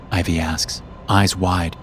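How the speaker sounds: plain reading voice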